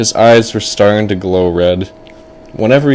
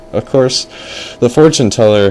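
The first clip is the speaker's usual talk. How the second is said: usual speaking voice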